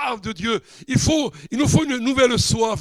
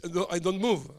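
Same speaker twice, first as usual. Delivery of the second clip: ordinary talk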